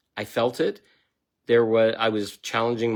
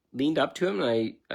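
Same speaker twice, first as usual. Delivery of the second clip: normal talking voice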